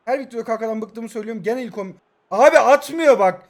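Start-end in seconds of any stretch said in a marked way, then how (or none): 2.3-3.4 loud, high, drawn-out protest; frustrated complaint. Real, not acted. Player complains about the failed pass, frustrated. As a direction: whine loudly that it isn't working.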